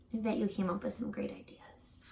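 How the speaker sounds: softly whispered, without voice. Real, not acted. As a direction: whisper it as if sharing a secret with the class. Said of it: "Whisper one to yourself".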